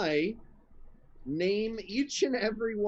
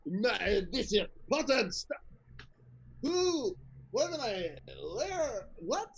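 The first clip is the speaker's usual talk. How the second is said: put-on high, confused French-accented ogre; woozy French ogre accent; dazed French ogre; 4 semitones higher than usual. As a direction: stagger through it like a drugged ogre. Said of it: "combine my ogre voice with a French accent".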